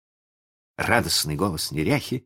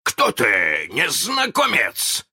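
high, slow, loud demand; shouted challenge; 9 semitones higher, 4 dB louder, much slower than usual. A narrator's reading. Hook challenges the unseen voice, high cry. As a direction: call out into the unknown, pitch climbing. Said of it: "закричал он".

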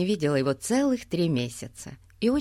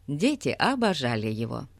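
usual speaking voice

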